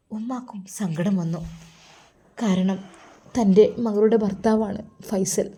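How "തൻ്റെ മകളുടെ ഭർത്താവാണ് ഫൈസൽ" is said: ordinary delivery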